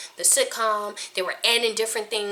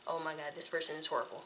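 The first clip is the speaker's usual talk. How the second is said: 1 mock judge murmur; 5 semitones lower, 10 dB quieter than usual. Imagined casting director verdict.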